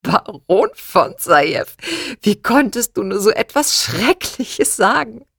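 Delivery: mother scolds her daughter mid-laugh. Acted. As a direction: scold while still shaking with laughter.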